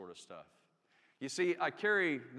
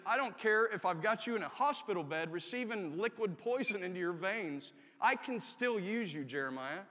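raised, punched preacher's delivery; emphatic preaching; 4 semitones higher, 3 dB louder than usual. Acted. God's promise to the sick chaplain, preached with force. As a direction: drive it home, slowing on the promise.

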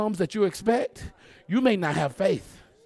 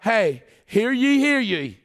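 a hint of a royal herald's proclamation; lightly acted king; 5 semitones higher, 6 dB louder, slower than usual. Preacher acting the king addressing his people, mock proclamation. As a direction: call it out as a mock decree. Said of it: "The king was like".

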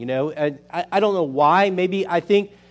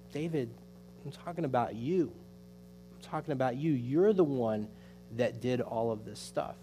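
hushed, grave retold accusation; quiet confrontation; 3 semitones lower, 11 dB quieter than usual. Prophet Nathan confronts King David with his guilt, quiet confrontation. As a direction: drop well below usual volume to confront him.

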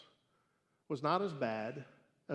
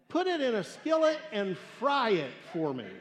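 put-on higher, louder demanding kid; bossy child; 5 semitones higher, 5 dB louder than usual. Little boy ordering his mom to fry the worm, bossy.

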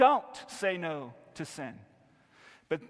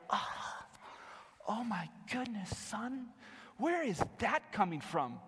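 shocked parent; 4 semitones higher, 5 dB quieter than usual. Retold or imitated. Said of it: "we're like".

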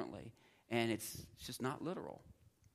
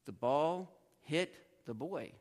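slow and loud, BALL drawn out, pause before "hit"; word-by-word demo; 3 semitones higher, 6 dB louder, much slower than usual. Shown as a demonstration. Teacher showing Greek word order to students, slow word-by-word demo. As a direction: lay out "ball", "hit", "boy" as separate slow chunks. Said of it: "it could be".

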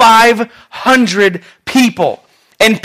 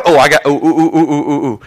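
low, repeated eager oohs; eager know-it-all pupil; 5 semitones lower than usual. Acted. Peter as the guy in class, eager. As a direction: beg to be called on. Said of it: "He's the guy in class, always knows".

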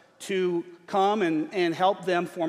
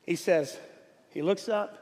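ordinary delivery

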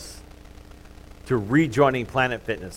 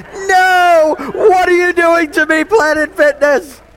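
hammed-up wail, much higher and louder; mock anguished cry; 10 dB louder, slower than usual. Pastor hamming up despair at the gym's extended sale. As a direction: cry out to the heavens in fake despair.

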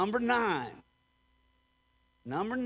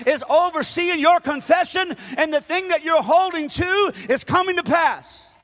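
loud, higher, fervent preaching climax; preaching at full force; 5 semitones higher, 8 dB louder than usual. Acted. Pastor rallying the congregation to hold on, fervent shout. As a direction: drive it home, louder with every line.

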